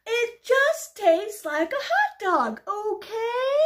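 slow, high, loud insistence; exasperated pigeon. Acted. Pigeon answering the nagging duck, exasperated. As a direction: spell it out loudly, patience running out.

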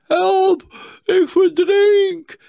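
high, loud, slow scream for help; drawn-out panicked cry; hoarse with fear. A narrator's reading. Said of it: "hees van angst".

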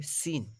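সিন with a light, thin "s". Shown as a demonstration. Teacher describes small seen, সিন thin and light. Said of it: "পাতলা হবে".

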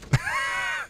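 a sudden high yelp; startled shriek. Real, not acted. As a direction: yelp in shock. Screams at game death.

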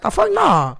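very high scoffing; high dismissive. Retold or imitated. Dismissing coworker's fear, high scoff. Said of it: "I was like".

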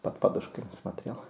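unvoiced whispered hint; whispering. Real, not acted. Подсказка ребёнку. (шёпотом)

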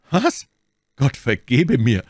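high, loud astonished outburst; outraged exclamation. A narrator's reading. Buckingham reacting to Felton's warning, loud outrage. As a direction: burst out in shocked indignation. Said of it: "rief Buckingham".

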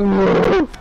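loud startled yell; loud cry. Real, not acted. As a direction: yell out as the blow lands. Hit in game.

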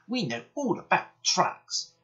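high, slow, cocksure voice; drawn-out youthful bragging; boastful twins. A narrator's reading. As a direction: boast in a high little-engine voice. Twins boast to each other.

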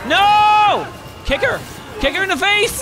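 very high, frantic screaming; panicked scream. Real, not acted. Player screaming at the monster attacking him, panicked scream. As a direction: scream for your life.

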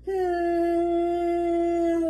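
high, loud hummed drone; humming buzz; fridge hum. Acted. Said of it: "went the refrigerator".

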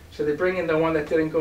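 ordinary delivery

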